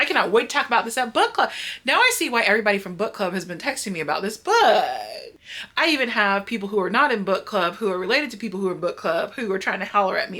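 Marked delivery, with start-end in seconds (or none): 0.0-7.2 loud, fast, exasperated eagerness; worked-up rant. Real, not acted. Reader venting to viewers about book club, worked up. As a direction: burst out, rushing and emphatic.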